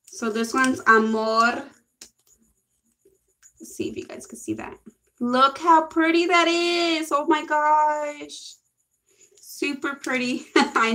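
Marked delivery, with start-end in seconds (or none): 3.6-4.8 fast hushed whisper; a quick whisper to viewers; whispered aside. Real, not acted. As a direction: murmur it under your breath.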